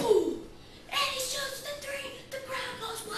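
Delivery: excited high announcer call; frantic mock sports commentary. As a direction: hype the shot like live TV.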